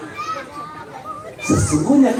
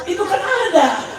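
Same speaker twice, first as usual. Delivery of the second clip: higher-pitched neighbour's protest; insistent neighbour; 11 semitones higher than usual. Acted. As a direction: play a neighbour insisting to a child. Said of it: "kata tetangga".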